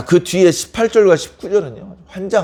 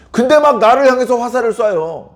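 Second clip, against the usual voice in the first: usual speaking voice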